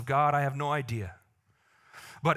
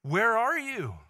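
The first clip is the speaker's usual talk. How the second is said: slightly higher searching call; God calling out; 5 semitones higher, 3 dB louder, slower than usual. Acted. God calls to people today, searching call. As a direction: call out gently, reaching. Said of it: "he's still saying that today".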